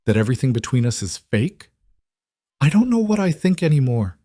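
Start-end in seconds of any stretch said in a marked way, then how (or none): 2.6-4.2 cracking with held-back tears. A narrator's reading. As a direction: voice breaking on the words. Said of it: "Elena's voice cracked".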